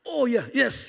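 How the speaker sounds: playful upbeat yes; mock excited reply. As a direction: cheer the kid on. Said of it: "saya bilang".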